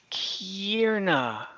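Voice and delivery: ordinary delivery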